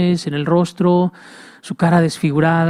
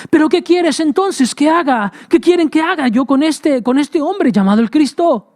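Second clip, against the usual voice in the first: exasperated Pilate; 9 semitones higher than usual. Acted. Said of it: "decía Pilato".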